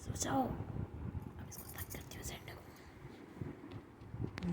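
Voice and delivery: slow hushed whisper; whispering over a crib. Real, not acted. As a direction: whisper so the pet stays asleep. Hushing pet back to sleep. (सोते बच्चे के पास धीमी फुसफुसाहट)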